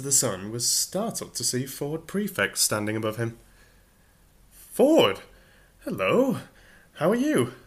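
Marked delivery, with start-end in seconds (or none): 4.5-7.5 much higher and louder, bursting with surprise; excited greeting. Acted. Arthur greeting Ford from the mud, excited. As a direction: Arthur spots an old friend and cries out.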